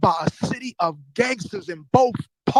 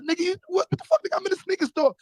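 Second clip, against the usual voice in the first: high-pitched mock outrage; retold disbelief; 10 semitones higher, faster than usual. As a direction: squeak it out in disbelief.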